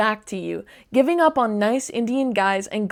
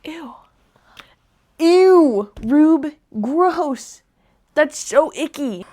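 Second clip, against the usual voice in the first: grossed out; 5 semitones higher, 5 dB louder, slower than usual. Real, not acted. Reacting to creepy messages.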